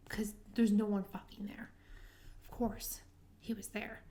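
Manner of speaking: dropping to a whisper for suspense; whispered suspense build; hushed storytelling. Real, not acted.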